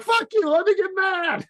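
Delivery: a hammed-up furious shout at his girlfriend; mock angry yell. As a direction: yell it like a fake tantrum in line. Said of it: "getting mad".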